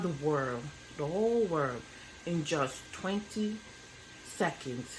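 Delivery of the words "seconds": ordinary delivery, nothing marked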